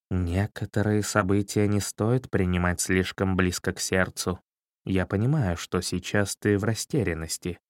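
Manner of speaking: soft, hushed and gentle; whispering. A narrator's reading. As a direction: lean in and say it softly. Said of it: "прошептал".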